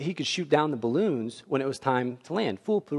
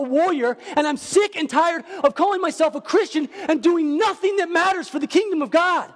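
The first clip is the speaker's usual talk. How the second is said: higher and louder, building to a shout; preaching at full force; 11 semitones higher, 3 dB louder than usual. Real, not acted. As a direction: preach it hard, rising to a cry.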